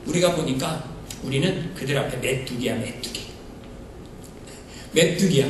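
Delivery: low, soft, defeated murmur; fearful muttering. Retold or imitated. Preacher voicing the ten spies whispering defeat, quiet fear. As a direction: shrink down like a grasshopper.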